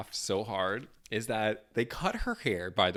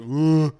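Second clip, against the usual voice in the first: loud imitated groan of effort; strained groan; 4 semitones higher, 11 dB louder than usual. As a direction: groan loudly as if forcing out an accent. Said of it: "you could hear her kinda like".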